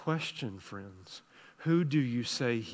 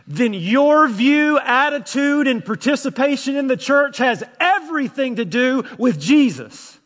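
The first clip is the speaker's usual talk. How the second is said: louder, higher rousing sermon; emphatic preacher; fervent preaching; 11 semitones higher, 10 dB louder than usual. Acted. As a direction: drive the question home. Preaches at full force.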